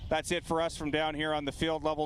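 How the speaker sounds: ordinary talk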